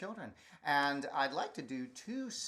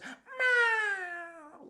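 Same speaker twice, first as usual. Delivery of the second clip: high, drawn-out meow; mama cat meow; 6 dB louder than usual. Acted. Performer to children, mama cat thanking the firefighter. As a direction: meow like a grateful mother cat. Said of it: "in kitty cat language".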